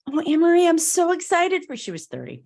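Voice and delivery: high, loud, delighted gush; gushing excitement. Retold or imitated. Youth group leader gushing over the coming out, high and loud. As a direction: burst out thrilled for her friend. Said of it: "and she goes".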